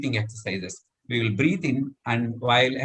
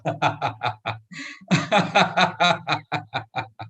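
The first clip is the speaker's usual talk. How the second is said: hearty demonstration laugh; demo laugh; 3 semitones higher than usual. Shown as a demonstration. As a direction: belly laugh on cue. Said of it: "So just laugh it off".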